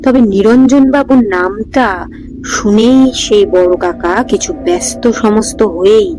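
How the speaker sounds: usual speaking voice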